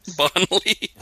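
"Bonley" queried high, with an L; New Zealand pronunciation. Shown as a demonstration. Host justifies the slip, "Bonley" in mock New Zealand pronunciation. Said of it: "That's how Bonnie's pronounced in New Zealand".

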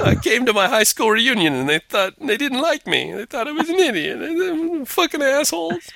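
high, whining complaint; whiny complaining voice. Retold or imitated. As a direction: whine like a sulking teen. Jesus as whiny reunion complainer.

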